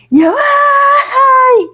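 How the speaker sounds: high, long wailing cry; imitated anguished cry. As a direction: let out a stretched sung wail of pain. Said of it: "Eu tô tentando imitar assim".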